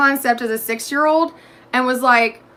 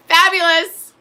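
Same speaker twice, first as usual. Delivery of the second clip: chipper answer; 7 semitones higher, 4 dB louder, slower than usual. Retold or imitated. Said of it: "I'm like".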